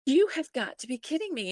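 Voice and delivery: high-pitched incredulous protest; can't believe the news, pitch leaping up; retold disbelief. Retold disbelief at hearing second boy.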